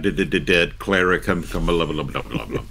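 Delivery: mock stammer into trailing blah-blah; piling on syllables. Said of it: "We enjoy putting syllables on these words".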